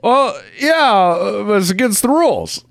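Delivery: high, dopey advisor voice; whiny advisor voice. Retold or imitated. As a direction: play a clueless rep, pitched high. Quoting the fund manager.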